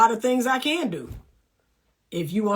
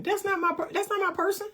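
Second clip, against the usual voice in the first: breezy dismissal; 9 semitones higher, 4 dB quieter, faster than usual. Real, not acted. Dismissing unsuitable men.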